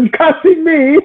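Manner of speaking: high-pitched imitation of the quote; high mock quote. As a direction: squeak it out mockingly. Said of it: "man was like".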